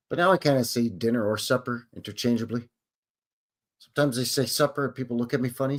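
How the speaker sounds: ordinary delivery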